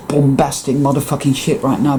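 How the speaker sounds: ordinary talk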